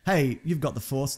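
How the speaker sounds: usual speaking voice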